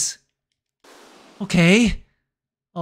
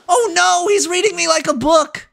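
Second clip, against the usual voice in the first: high, strained panic; real dread as the kicker reads you; oh no, he's got me figured out; panicked; 12 semitones higher, 4 dB louder than usual. Real, not acted. Tense penalty shootout, panicking.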